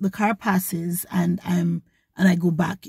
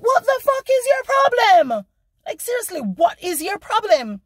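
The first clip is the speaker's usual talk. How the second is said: exasperated yell; 12 semitones higher than usual. Retold or imitated. To a driver blocking her car, an exasperated yell. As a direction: let the frustration burst out high.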